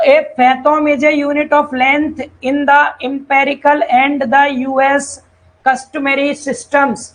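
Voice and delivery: reading the English definition word by word; slow read-aloud. Acted. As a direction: spell it out very slowly for the class. Reads English definition slowly. (धीरे-धीरे पढ़कर सुनाना)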